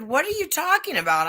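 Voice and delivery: high-pitched indignant comeback; retold protest. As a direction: replay your disbelief at being accused. Retold quarrel, protesting back.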